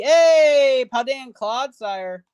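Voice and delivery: loud high hey, then slow drawn-out name; excited greeting. Real, not acted. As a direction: greet the card happily, stretching its name. Excited at pulling Clodsire.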